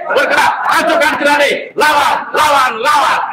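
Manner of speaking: high rally chant, punching each word; fiery revolutionary speech. Real, not acted. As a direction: rouse the crowd, rising shout. Union leader fires up rally.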